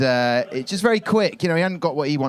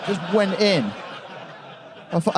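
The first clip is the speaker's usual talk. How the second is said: usual speaking voice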